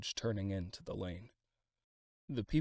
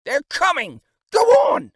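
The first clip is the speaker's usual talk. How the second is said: 1 crowd shouting; 21 dB louder than usual. A narrator's reading.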